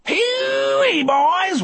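high, wild convict's holler; gleeful mad whoop. A narrator's reading. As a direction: whoop like a crazed prisoner having fun. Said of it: "Wharton laughed".

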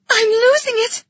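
high, loud cry of strain; panicked girl. A narrator's reading. Young girl shaking with effort calls out, panicked. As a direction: cry out as if about to lose control.

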